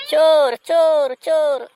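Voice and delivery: loud drawn alarm shout; shouting thief. Acted. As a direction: yell thief after them. Owner shouts thief again.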